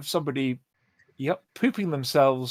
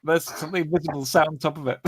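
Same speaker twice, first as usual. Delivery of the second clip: ordinary delivery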